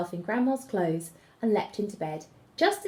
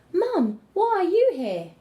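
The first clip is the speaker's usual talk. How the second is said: excited; 10 semitones higher, 6 dB louder than usual. Acted. Girl rescued, running to mother.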